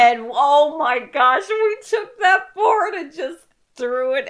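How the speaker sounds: high, loud frightened rush; panicked. Retold or imitated. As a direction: relive the panic.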